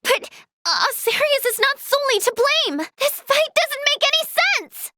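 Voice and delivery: high, agitated objection; upset protest. Acted. Healer defends Asarius against blame, upset and agitated.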